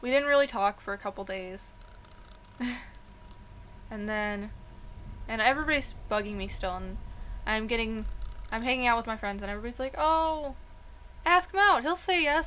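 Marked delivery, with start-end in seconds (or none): 2.6-3.8 soft airless laugh; quiet embarrassed laugh; breathy laugh. Real, not acted. Laughing at her own embarrassment. 10.0-12.5 high, loud mock urging; eager friends. Friends urging her again.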